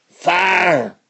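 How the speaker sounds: high, drawn-out "fire"; shouted command. Acted. Outlaw boss orders his gang to shoot, shouted command.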